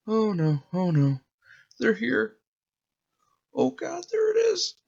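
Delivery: rising to a very high panicked squeak; a child's fear building to panic; terrified little boy. Acted. Boy sees shadowy figure.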